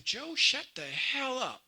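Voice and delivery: lightly voiced impatient scolding; wife snapping at her husband, mildly done; irritated wife. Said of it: "she would say".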